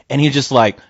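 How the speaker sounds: usual speaking voice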